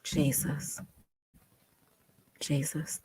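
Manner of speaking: whispered, with long silences; soft, overcome whisper; stunned, near tears. Real, not acted. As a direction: breathe it out in disbelief.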